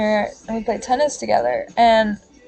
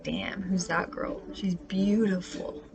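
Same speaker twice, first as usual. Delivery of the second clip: soft, breathy whisper to herself; hushed self-awe; 3 semitones lower, 13 dB quieter, slower than usual. Retold or imitated. Watching her own video, she whispers in quiet awe. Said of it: "I'm like".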